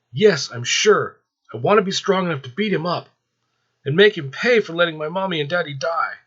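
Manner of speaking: put-on a little boy making a fierce vow; determined child. Bobby to Azrael, vowing revenge in a high child's voice.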